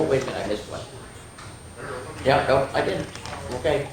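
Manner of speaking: usual speaking voice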